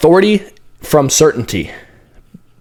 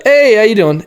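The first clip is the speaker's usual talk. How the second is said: upbeat client greeting; 9 semitones higher than usual. Shown as a demonstration. Coach voicing a high-energy client greeting on a call. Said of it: "But if they're like".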